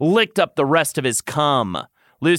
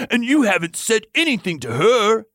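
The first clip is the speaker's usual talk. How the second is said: higher, indignant exclamation; exclaimed; 9 semitones higher than usual. A narrator's reading. Lucian confronts the lover over the silence, exclaiming. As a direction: exclaim in disbelief. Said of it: "Lucian exclaimed".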